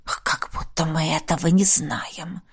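soft, slow, tired rustle; rustling whisper. A narrator's reading. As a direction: breathe it wearily at his ear. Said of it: "прошелестел над его ухом тоже усталый голос духа". (усталым шелестом)

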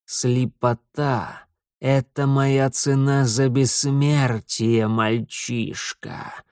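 very slow, ominous lord of darkness; dark lord. Acted. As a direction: intone it slowly, like a dark god. Setil answers.

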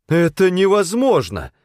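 high, loud disbelieving cry; exclaiming. A narrator's reading. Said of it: "воскликнула она".

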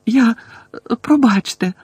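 high, halting, tearful words; distressed, broken-up babble; stammered apology. A narrator's reading. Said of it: "пробелькотіла Герміона".

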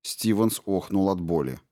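usual speaking voice

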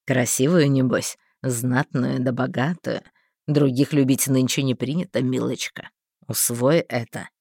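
low, pleased schemer; gleeful sly man. A narrator's reading. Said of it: "обрадовался Тонкий".

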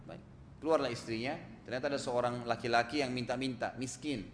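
ordinary delivery

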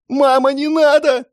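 put-on very high, loud child's cry; frightened little boy. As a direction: scream for mum as a terrified child. Boy screams for his mother. (отчаянный детский крик)

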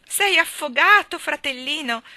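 high, loud and slow, panicked wail; frantic exclamation. Acted. Little sister wailing at her soaked brother, panicked high cry. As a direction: cry out as if he has already drowned. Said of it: "esclamò Geraldine".

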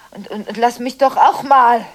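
loud, hurt outburst; angry outburst. Acted. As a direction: let it burst out at him. Outburst at partner.

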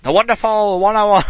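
a light Iwata impression in a Japanese accent; Iwata's accent. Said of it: "I could do Iwata's accent".

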